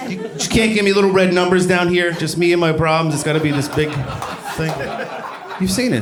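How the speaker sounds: ordinary talk, nothing marked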